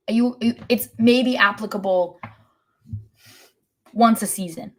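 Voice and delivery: normal talking voice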